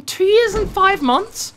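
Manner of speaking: high, loud astonished question, a bit slow; can't believe the number. Real, not acted. Reading the Supreme King's chat stat, astonished and loud.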